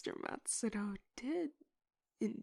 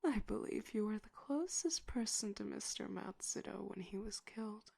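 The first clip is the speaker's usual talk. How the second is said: ordinary talk, nothing marked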